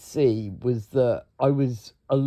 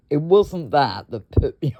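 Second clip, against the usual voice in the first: normal talking voice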